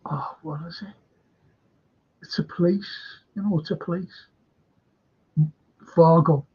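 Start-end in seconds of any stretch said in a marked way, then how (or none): 0.1-4.3 whispering to himself while searching; hushed, trying to remember. Real, not acted. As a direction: mutter it under the breath. Searching for the name.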